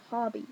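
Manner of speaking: "Tobby" with a short open AH in the first syllable; "Tobby", rhyming with hobby; pronounced TOBBY. Shown as a demonstration. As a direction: say "Tobby" like TAH-bee. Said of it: "Not Toby".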